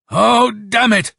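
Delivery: high, slow cry of frustration; pained, angry shout. A narrator's reading. Colonel seeing the enemy, shouting in anguish. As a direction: shout it out in pain and anger. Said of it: "he shouted out in pain and in anger".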